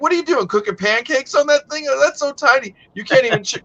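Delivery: high, teasing disbelief at a tiny grill; mock ribbing. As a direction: rib a friend about his grill, pitched well up. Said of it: "I'm like".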